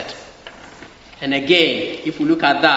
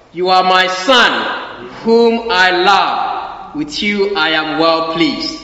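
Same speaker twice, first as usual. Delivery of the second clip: solemn slow voice of God; 2 semitones higher, 5 dB louder, slower than usual. Acted. Preacher voicing God's words at baptism.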